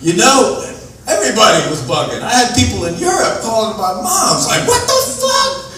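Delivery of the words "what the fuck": high, slow disbelieving; mock shocked. People in Europe calling his mom, high disbelief. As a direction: squeal it out slowly.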